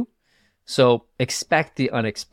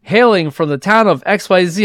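high, loud host intro; announcer voice; 8 semitones higher, 5 dB louder than usual. Retold or imitated. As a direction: announce him like an emcee. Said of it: "And he goes".

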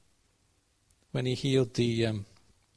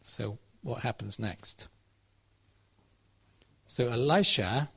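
usual speaking voice

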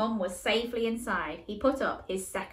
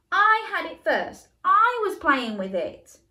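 indignant protest; 8 semitones higher, 7 dB louder than usual. Acted. Big brother defends toy.

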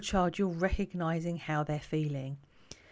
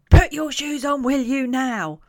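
put-on sharp, loud and high bark at the child; snappy parent nagging about shoes; 7 semitones higher, 12 dB louder than usual. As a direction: snap the order out, fed up. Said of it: "quite snappy as the parent".

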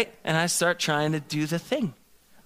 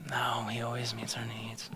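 whispered, much softer, grudging; sheepish whisper; 4 semitones lower, 11 dB quieter than usual. Retold or imitated. Husband to wife, grudgingly admitting she's right, in a sheepish whisper. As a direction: whisper it like a caught-out kid.

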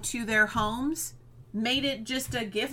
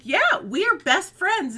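higher, louder, slow and proud; proud agreement; 8 semitones higher, 7 dB louder than usual. Retold or imitated. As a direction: say it proudly and slowly. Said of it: "Katrina was like".

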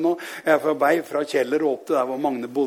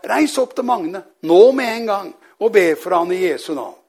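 retold urgent command, higher, pushed loud; 4 semitones higher, 6 dB louder than usual. Holy Spirit sends preacher up to Magne, urgent command. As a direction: send him off at once, emphatically.

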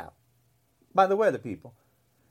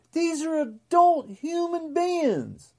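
normal talking voice

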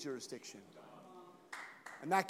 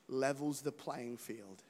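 ordinary delivery

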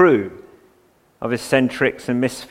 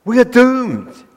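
higher, louder mock despair; gloomy catchphrase; 8 semitones higher, 4 dB louder, slower than usual. Preacher mocking worldly pessimism, hammed-up despair. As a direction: ham up the doom. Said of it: "horror story after horror story".

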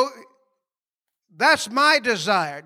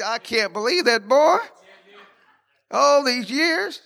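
math teacher; 3 semitones higher than usual. Retold or imitated. Preacher mimicking his math teacher, mock disbelief. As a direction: stretch out all these years slowly in mock disbelief. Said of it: "My math teacher's like".

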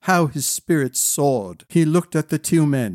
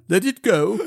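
higher-pitched practised instruction; coaching child; 5 semitones higher than usual. A narrator's reading. Cower telling Malady to exhale, coaching. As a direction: guide her like a small helper.

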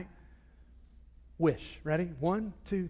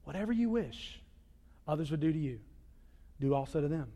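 hushed verse; 5 semitones lower, 10 dB quieter than usual. Shown as a demonstration. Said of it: "This is the law and the prophets".

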